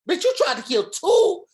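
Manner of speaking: high, loud, laughing outrage; outraged laughing rant. Retold or imitated. Telling the co-host his reaction to the video, laughing high and loud. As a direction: laugh it out in disbelief.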